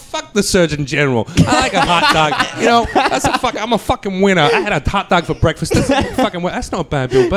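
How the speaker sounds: grumbling comic in a hint of Boston; a mild Boston comic's rant; Bill Burr Boston impression. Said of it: "That was my Bill Burr".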